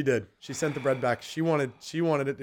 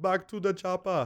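drawn-out, higher action-hero impression; Arnold Schwarzenegger; 5 semitones higher than usual. Joking that his friend aborted the chat, Schwarzenegger impression. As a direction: do a slow Austrian action-star voice. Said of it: "You went Arnold Schwarzenegger".

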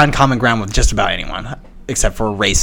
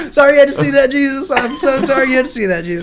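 high, sugary voice apologising to Jesus; mock pious apology; 14 semitones higher than usual. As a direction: play the sheepish holy man. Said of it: "he's like".

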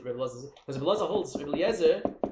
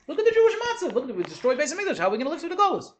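raised, high, loud outcry; agitated lament; 12 semitones higher, 7 dB louder, faster than usual. Acted. Sages lamenting the destroyed Temple, agitated high outcry. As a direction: cry out in mock distress at the ruin.

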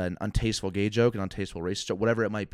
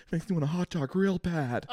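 Jennifer Coolidge impression; 8 semitones higher than usual. S1 quotes Coolidge line.

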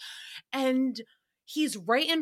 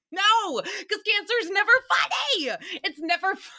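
high emotional outburst; 9 semitones higher than usual. Real, not acted. Sarcasm breaks into real grief.

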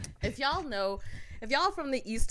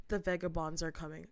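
low, soft imitation of a stranger; quiet ominous stranger; 5 semitones lower, 6 dB quieter than usual. Train stranger repeats his warning to a passenger, quiet imitation. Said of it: "he gets louder now".

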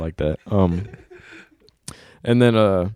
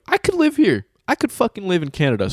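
excited; 9 semitones higher than usual. Retold or imitated. Retelling delight at Canada.

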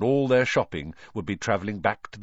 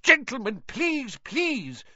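much higher, desperate plea; cried out; slower than usual. Acted. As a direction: beg them to stop in a high panic. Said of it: "cried the assistant".

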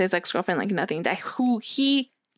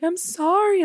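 higher-pitched mock-tearful apology; raised-pitch retelling of a tearful sorry; retold tearful apology; 9 semitones higher, slower than usual. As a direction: lightly imitate apologising while crying. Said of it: "Like, I started crying".

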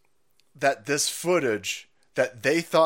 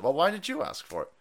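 ordinary talk, nothing marked

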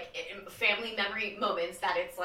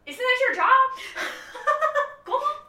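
high, loud mocking voice; sassy retort; 7 dB louder than usual. Kid silently answering the parent lecture, high and sassy. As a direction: talk back with a scoff. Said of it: "in my head, I was like".

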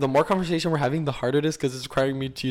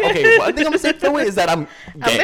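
excited; 9 semitones higher, 5 dB louder than usual. Retold or imitated. Proud coming out quote.

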